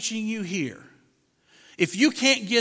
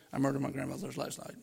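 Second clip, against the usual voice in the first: quick, soft mumble under the breath; mumbled; 6 semitones lower, 10 dB quieter, faster than usual. Retold or imitated. President mumbling to guests in the reception line. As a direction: swallow the words so nobody catches them. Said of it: "he mumbled".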